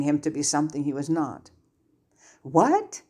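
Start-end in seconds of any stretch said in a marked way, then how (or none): 2.4-3.0 a high, startled what; stunned disbelief, pitched up; utter surprise. A narrator's reading. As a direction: caught completely off guard. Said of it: "Granger said in utter surprise".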